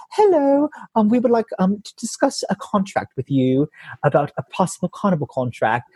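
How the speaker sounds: high, bright British voice imitating the caller; imitating a British casting agent on voicemail; British lady. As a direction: put on a British accent for the polite lady on the phone. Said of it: "It was a British lady".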